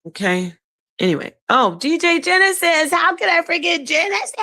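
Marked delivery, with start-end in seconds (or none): 1.5-4.3 high, excited outburst on remembering a friend; sudden high-pitched recognition; excited exclamation. Real, not acted.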